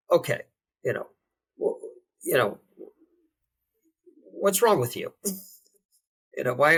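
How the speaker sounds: a self-critical voice venting frustration; critical inner voice. Retold or imitated. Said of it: "my inner monologue starts to think about".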